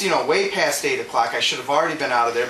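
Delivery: usual speaking voice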